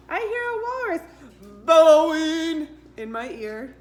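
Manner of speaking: bellowing. Acted. Walrus bellowing, reading to kids.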